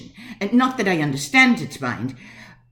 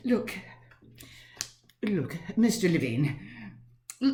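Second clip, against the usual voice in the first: ordinary talk, nothing marked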